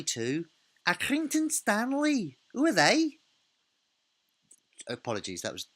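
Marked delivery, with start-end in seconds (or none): 0.9-3.2 high, slow, hammed-up Liverpudlian accent from the milk advert; a deliberately bad Liverpudlian accent, high and loud; terrible Liverpudlian accent. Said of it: "terrible accent".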